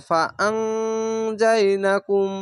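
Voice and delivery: ফা আং যাই না কুম with a humming ং; ikhfa ghunnah. Shown as a demonstration. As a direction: hold the nasal ং in আং before যাই. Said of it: "ওয়ান স্কার মতো". (আং-এর ং নাকে টেনে গুন্না)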